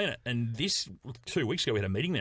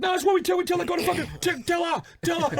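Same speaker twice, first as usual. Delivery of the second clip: high, loud insistent shouting; imitated excitable fan; 5 dB louder than usual. Fan justifying the heckle to his wife, shouted. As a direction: shout it over and over, overexcited.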